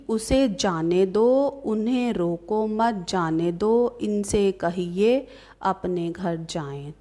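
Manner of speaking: drawn-out, deliberate dictation pace; slow example reading. Shown as a demonstration. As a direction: read each phrase slowly for the class. Teacher reads out example sentences. (धीरे-धीरे, खींचकर पढ़ते हुए)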